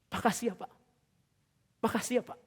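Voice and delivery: soft, halting mock-tearful thanks; imitating tearful thanks. Grateful guard thanking the pastor, softly imitated tearful voice. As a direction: thank him as if holding back tears. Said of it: "dia nangis".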